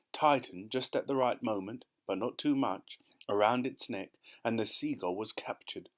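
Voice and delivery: ordinary delivery, nothing marked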